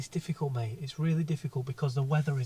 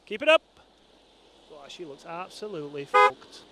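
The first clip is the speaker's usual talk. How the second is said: normal talking voice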